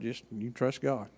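ordinary talk

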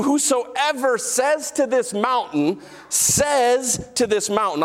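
"says to this mountain" stretched out slowly, leaning on "to"; slow emphatic repetition. Shown as a demonstration. Preacher drills the verse into the congregation, slow and emphatic. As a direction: drag out "says to this mountain" for weight. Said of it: "I'll say it again".

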